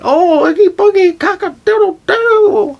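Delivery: shrieking, unhinged nonsense squeal; mock freak-out. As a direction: ham up Annie losing it. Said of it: "So Annie freaks out".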